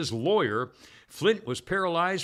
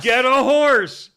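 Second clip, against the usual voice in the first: high, loud, slow mocking yell; 8 semitones higher, 11 dB louder, slower than usual. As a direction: shout it like a heckler from the crowd. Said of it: "somebody yelled".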